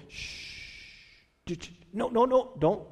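hushed shushing, then gentle no; soothing shush. Retold or imitated. Priests calming weeping people, hushed and soothing. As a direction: shush them like calming a child. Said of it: "going, going".

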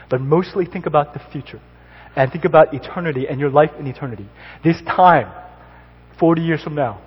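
rising into loud exhortation; building preaching. Acted. Pastor urging the congregation to think of eternity, building to loud. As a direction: push the call to eternity harder each line. Closing exhortation before prayer.

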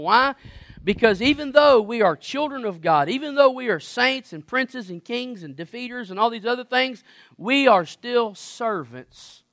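loud, rising preacher build; preaching at full force. Acted. Preacher lifting the church to its climax, loud. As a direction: drive it home to the congregation.

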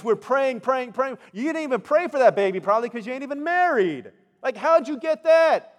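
high, loud mock outrage; hammed-up affronted older relative; indignant scolding. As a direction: build from incredulous questions into a loud, high rant. Imagined Elizabeth scolds pregnant Mary.

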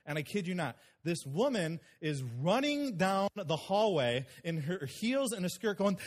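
normal talking voice

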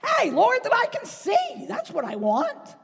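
high, loud and certain; bold shouted request. Acted. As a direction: shout out exactly what you want. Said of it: "He says".

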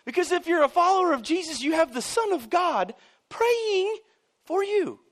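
loud, high, impassioned preaching; loud, high preacher at full throttle; full-force preaching. Real, not acted. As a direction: drive it home like a revival preacher.

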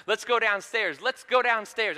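put-on high-pitched child's call and its copycat echo; imitated excited kids. Little sister repeating big sister's words, excited mimicry. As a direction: pitch it up like eager little girls. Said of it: "Just imitating everything".

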